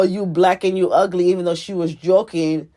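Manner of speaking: ordinary talk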